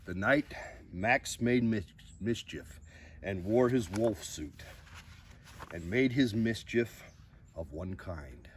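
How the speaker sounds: lively storybook reading with big rises; reading to children. Acted. Host reading a picture book to viewers, expressive storybook voice. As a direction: read the bedtime book aloud with drama.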